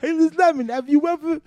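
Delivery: high, loud catchphrase; very high, loud comedian impression; Tracy Morgan. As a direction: call the name high and loud as a caricature. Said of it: "You got that Tracy Morgan".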